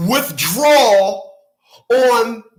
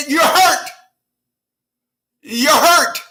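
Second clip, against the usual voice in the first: mock accusation; 6 semitones higher, slower than usual. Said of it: "they make the claim that you're hurt".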